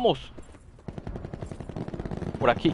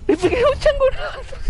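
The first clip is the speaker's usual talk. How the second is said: excited repeat. Real, not acted. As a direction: say it again, pushing it up high.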